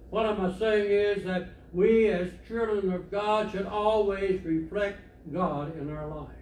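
usual speaking voice

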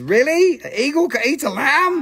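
high, loud disbelief; incredulous surprise. Real, not acted. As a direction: question it in high-pitched amazement. Reacts to eagle eyeing baby lambs.

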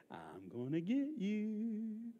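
long drawn-out, rising mock menace; teasing sing-out to a child about to be chased; playful chase threat. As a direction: creep up slowly on "gonna get you".

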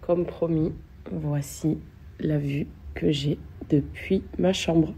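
croaky morning voice; rough just-woke-up voice; vocal fry. Real, not acted. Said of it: "J'ai ma voix du matin fracassée".

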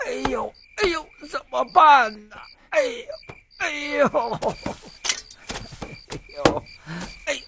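whimpering groans, wavering; pained groaning. Acted. Old man groans about his aching back, pained.

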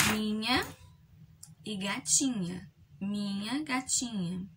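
"Minha" and "gatinha" loud and drawn out; syllable emphasis. Shown as a demonstration. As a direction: say "Minha gatinha" slowly, leaning on the nha. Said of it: "Vocês vão circular o quê?".

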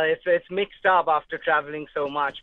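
usual speaking voice

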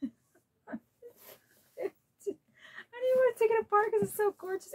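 high and fast, giddy; excited gushing. Real, not acted. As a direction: rush it out in delight. Gushing over wrapping.

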